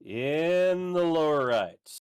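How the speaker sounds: slow, drawn-out announcer intro; fighting game announcer. Shown as a demonstration. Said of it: "announcer voice going".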